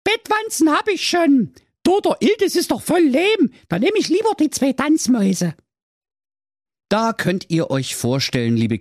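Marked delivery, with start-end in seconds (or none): 0.0-5.5 put-on high, pleading girl voice; whiny teenage daughter. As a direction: play the spoiled fairy-tale daughter wishing aloud. Daughter chooses.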